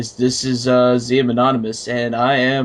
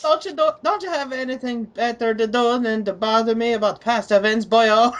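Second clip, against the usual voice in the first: high, hammed-up Irish leprechaun accent; mock Irish leprechaun echoing the comment; 9 semitones higher than usual. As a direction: squeak it like a cartoon Irish leprechaun. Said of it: "I'm a fucking leprechaun".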